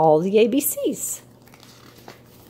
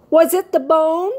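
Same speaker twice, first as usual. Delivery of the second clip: calling out; 8 semitones higher, 3 dB louder than usual. A narrator's reading. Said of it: "he called to the bird".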